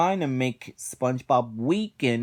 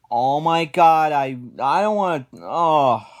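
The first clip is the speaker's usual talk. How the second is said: much louder, dreading outburst; loud exasperated groan; 2 semitones higher, 7 dB louder than usual. Real, not acted. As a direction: cry out like you can't bear to rewatch it. Dreading the next episode.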